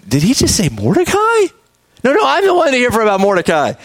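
mock-shocked protest; shocked disbelief. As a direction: play Haman reeling at what he just heard. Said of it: "can you imagine just the shock".

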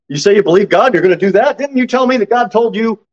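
mildly incredulous challenge, pitch climbing; challenging neighbor. Acted. Neighbor questioning Abraham's professed belief, challenging. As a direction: call him out on his claim.

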